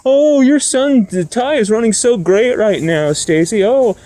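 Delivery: mock fake-nice fans gushing to a mother. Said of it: "They're all texting".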